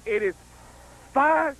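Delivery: high, heated, building announcer call; outraged, proving it's his call; indignant home run call. Pretend. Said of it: "indignant announcer rant".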